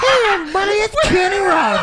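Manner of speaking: loud, high, laughing announcer voice. Pretend. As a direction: boom it out like a showman, laughing. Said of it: "I thought [name] was getting ready to come out".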